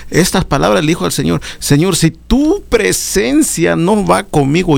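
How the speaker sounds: high, impassioned sermon delivery; fervent preaching. Acted. Preacher urging brothers to answer God's call, impassioned high preaching. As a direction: lift the congregation, preach with fervour. Preacher exhorting at full force.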